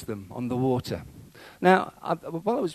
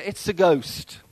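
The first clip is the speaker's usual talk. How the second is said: raised, louder cry of alarm; mild fearful cry; 5 semitones higher, 6 dB louder, much slower than usual. A narrator's reading. Disciples seeing a figure on the water, mildly fearful cry. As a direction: cry it out with a touch of terror. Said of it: "terrified and cried out in fear".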